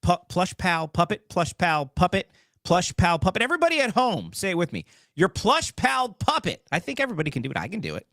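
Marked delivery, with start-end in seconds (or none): 0.0-3.4 tongue twister. Shown as a demonstration. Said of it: "Can I say that three times fast?".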